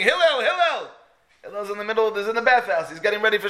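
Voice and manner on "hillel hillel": high-pitched mock scream; imitated urgent calling out. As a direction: holler the name like an impatient caller. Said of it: "he's screaming".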